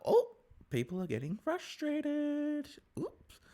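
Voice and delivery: high, slow, teasing; amused aside. Retold or imitated. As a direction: relish the drama.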